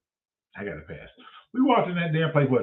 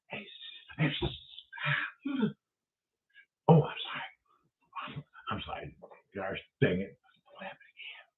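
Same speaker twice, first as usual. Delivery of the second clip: a hushed, overly polite theater-goer impression; polite whisper; 6 semitones lower, 11 dB quieter than usual. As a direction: whisper a timid apology.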